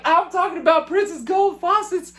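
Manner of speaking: giddy squeal. Retold or imitated. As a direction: marvel like a starstruck kid. Starstruck fans in [name]'s restroom.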